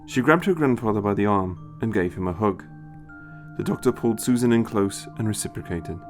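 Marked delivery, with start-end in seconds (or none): none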